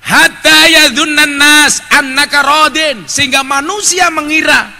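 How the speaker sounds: high, loud, measured sermon delivery; forceful preaching. Real, not acted. Preacher teaching the congregation about hiding anger, forceful preaching. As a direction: project each phrase slowly to a big crowd.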